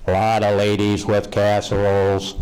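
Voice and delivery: monotone electrolarynx imitation; imitating dad's mechanical voice box; voice-box impression. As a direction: drone it like an artificial larynx. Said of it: "learn to talk with a voice box".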